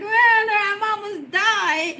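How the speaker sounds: slow and high, laughing through tears; half sob, half laugh, retelling her protest; laughing and crying. Retold or imitated. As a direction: wail it out while laughing. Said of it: "I'm like".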